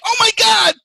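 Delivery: high, loud mock scream. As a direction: yell it like the first big drop. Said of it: "when I was like".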